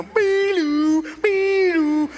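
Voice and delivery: siren imitation. [name] imitating ambulance siren.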